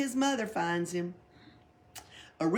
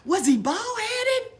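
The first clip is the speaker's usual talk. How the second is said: high call; 7 dB louder, slower than usual. A narrator's reading. Pippi calling after girl.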